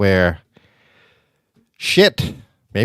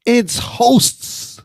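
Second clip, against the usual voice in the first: imitating wife; 12 semitones higher, much slower than usual. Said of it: "caught shit from my wife and my daughter".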